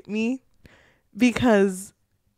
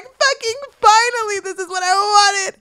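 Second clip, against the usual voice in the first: much higher and louder, bursting with relief; thrilled outburst; 12 semitones higher, 8 dB louder than usual. Retold or imitated. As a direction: yell it like you've waited forever for this. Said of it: "I was happy".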